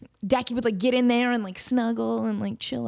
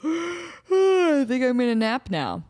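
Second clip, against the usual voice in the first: slow, sleepy ferret voice yawning the words; mock ferret yawn; 3 semitones higher, much slower than usual. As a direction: talk through a big, drawn-out yawn. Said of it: "trigger back there that they yawn".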